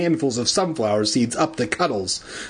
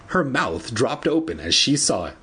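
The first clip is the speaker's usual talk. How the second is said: usual speaking voice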